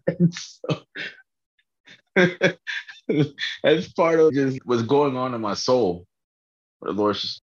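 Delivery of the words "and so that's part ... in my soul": high, giggly talk with real laughter; laughing. Real, not acted. Vlogger sharing what's going on in their soul, laughing. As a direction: try to carry on through leftover laughs. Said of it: "I'm laughing because".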